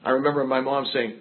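ordinary talk, nothing marked

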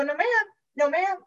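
high, polite refusal; high-pitched mock-innocent protest; insistent denial. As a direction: brush it off in a high, singsong denial. Retold flat refusal to sponsor.

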